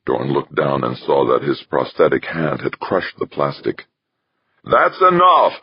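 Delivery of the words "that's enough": bawled high and loud, slurring; slurred bawl. Acted. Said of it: "the technician's slurred voice bawled".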